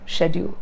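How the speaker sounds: SCHEDULE as an even "shed-yoo"; flat pronunciation. Shown as a demonstration.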